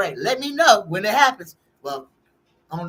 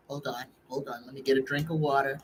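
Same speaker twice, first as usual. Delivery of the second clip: quiet aside; 5 semitones lower, 8 dB quieter, faster than usual. Real, not acted. Stepping away to drink water.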